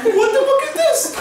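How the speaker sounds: very high squeaky voice; mock bewilderment. Vikings who can't skateboard, high-pitched bewildered squeal. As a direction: squeal it in comic disbelief.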